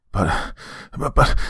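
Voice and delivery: halting stammer, rising in pitch; blubbering. A narrator's reading. Father caught out by the evidence, blubbering. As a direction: stumble over it, flustered. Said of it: "Dave blubbered".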